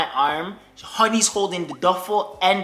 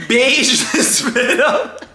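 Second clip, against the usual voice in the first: laughing; 4 semitones higher, 4 dB louder, much slower than usual. Real, not acted. Laughing describing baby's mess.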